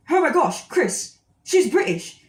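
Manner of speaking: excited, high and loud outburst; retold burst of high-pitched excitement; freaking out. Telling Chris the woman is British, freaking out. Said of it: "And I start freaking out".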